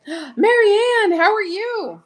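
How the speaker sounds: high, loud gasped hello; light up as she arrives; thrilled to see Marianne; excited greeting. Real, not acted. Greeting a viewer with a gasp.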